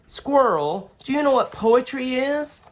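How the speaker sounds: put-on bright curious kid asking; young boy Daniel. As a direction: ask like a curious little boy. Daniel child voice.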